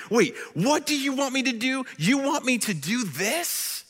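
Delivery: high, incredulous protest; angry inside. Retold or imitated. Preacher voicing Abraham's disbelief at God's request, high and incredulous. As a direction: voice the father's disbelief at God. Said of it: "he's angry inside saying".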